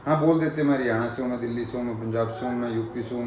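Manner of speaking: usual speaking voice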